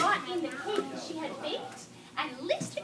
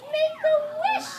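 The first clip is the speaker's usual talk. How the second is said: high, loud, slow call; cried out; 8 semitones higher, 8 dB louder than usual. A narrator's reading. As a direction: call it out with joy. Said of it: "cried Amy".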